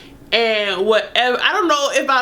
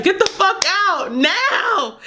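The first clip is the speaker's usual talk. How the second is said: high, slow furious yell; angry yell; 8 semitones higher, slower than usual. Retold or imitated. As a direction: yell it at the door. Yelling at partner in bathroom.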